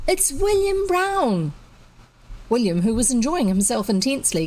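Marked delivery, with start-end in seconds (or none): 0.1-1.5 put-on high, small little girl's voice; small piping child. A narrator's reading. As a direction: pipe it up like a small girl. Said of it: "a small voice piped up".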